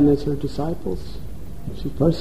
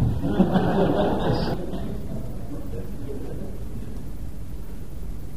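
eerie laugh; 6 semitones higher, 7 dB quieter than usual. Acted. Goddess laughs after threat.

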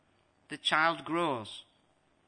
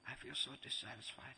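dissatisfied patient; 3 semitones lower, 11 dB quieter than usual. Acted. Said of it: "I have to go see the doctor".

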